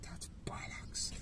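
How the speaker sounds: whispered scoff; breathy whispered "that's bollocks"; whispered dismissal. Retold or imitated. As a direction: hush it out dismissively. Dismissing old coaching advice.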